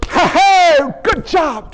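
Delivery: hammed-up buddy congratulation; mock cheering. Disciples imagined congratulating Jesus in the boat, mock cheering. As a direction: burst out high on the laugh, then ease off.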